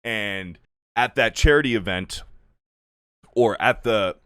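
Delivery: ordinary talk, nothing marked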